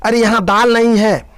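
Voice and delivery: high, loud complaining call. Acted. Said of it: "सास इधर चिल्लाती". (सास का ऊंचे स्वर में पुकारना)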